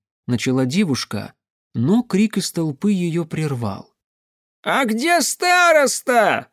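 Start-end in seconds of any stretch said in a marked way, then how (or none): 4.6-6.5 put-on high, drawn-out old man's cry; creaky old man. A narrator's reading. Old man calls from the crowd, creaky voice. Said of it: "спрашивал какой-то скрипучий старик".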